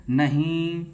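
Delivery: नहीं with its vowel stretched long and slow; drawn-out vowel. Shown as a demonstration. Teacher showing a class a long vowel, नहीं slowly drawn out. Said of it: "वहाँ पे हम दीर्घ तक बोलते हैं".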